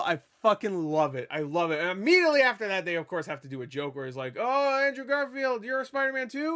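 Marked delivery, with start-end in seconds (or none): 4.4-6.5 mock amazement. Friend meeting a second Spider-Man, mock amazed. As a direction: gush in playful astonishment. Acted joke dialogue between film characters.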